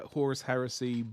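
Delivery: ordinary talk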